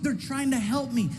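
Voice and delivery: usual speaking voice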